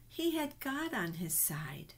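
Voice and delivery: soft whispered secret; storyteller sharing a secret with children, whispered. A narrator's reading. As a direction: lean in and whisper it to the kids.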